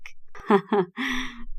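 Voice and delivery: short teasing chuckle; playful laugh. A narrator's reading. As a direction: laugh lightly along with the joke. Cousin joking, a laugh heard. (ضحكة خفيفة مرحة)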